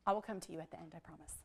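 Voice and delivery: low, very soft private reassurance; hushed aside. Real, not acted. Teacher promising one student a later answer, hushed aside. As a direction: drop right down to a near-murmur for one listener.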